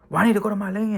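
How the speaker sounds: retold inmate protest. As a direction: push back like the annoyed inmate. Inmate's retort in retold quarrel.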